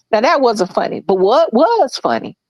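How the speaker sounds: ordinary talk, nothing marked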